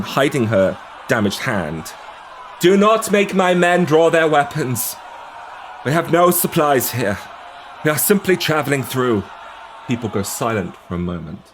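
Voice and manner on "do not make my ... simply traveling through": stern captain. Acted. Sansa warns the crowd off the barge, stern raised voice. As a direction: hold the line firmly.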